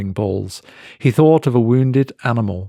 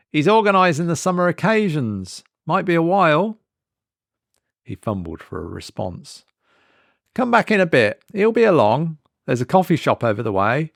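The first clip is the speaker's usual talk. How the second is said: higher voiced, offhand and bored; dismissive shop woman; 7 semitones higher than usual. A narrator's reading. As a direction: wave him off without looking up. Waitress-clerk character voice.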